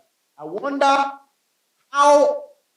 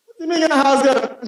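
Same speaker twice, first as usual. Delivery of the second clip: incredulous; 8 semitones higher, 8 dB louder than usual. Real, not acted. Incredulous at house girl excuse.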